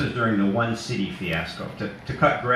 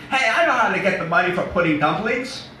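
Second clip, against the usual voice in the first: high, loud big simple man; simple Eddie; 9 semitones higher, 4 dB louder than usual. Acted. Narrator voicing simple Eddie to the kids, high and loud. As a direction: boom it out like an eager childlike giant.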